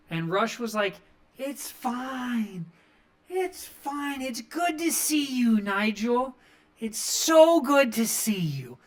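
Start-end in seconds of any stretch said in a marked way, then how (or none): 1.4-8.8 high, strained, forced cheer insisting he's fine; a dejected wrestler faking it's fine, pitched up. Retold or imitated. A downcast Lio Rush assures Nigel he's fine, in a high, forced-cheerful voice.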